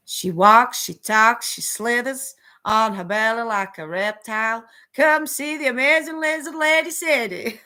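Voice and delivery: loud hammed-up American carnival barker pitch; brash American showman calling in the crowd. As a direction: sell the lizard lady to the crowd like a fairground barker. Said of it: "like an American carnival barker".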